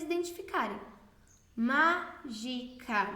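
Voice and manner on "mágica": very slow "Má - gi - ca", each syllable separate. Shown as a demonstration. Teacher asking which syllable is strongest, "Mágica" split syllable by syllable. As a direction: break "Mágica" into three parts with gaps between. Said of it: "Veja qual que é a sílaba mais forte".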